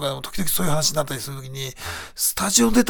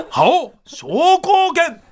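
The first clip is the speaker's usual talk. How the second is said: high, slow shouted attack name; special move shout; 12 semitones higher, 6 dB louder, much slower than usual. Shown as a demonstration. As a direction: bellow the super move like a game fighter. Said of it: "イントネーションね。".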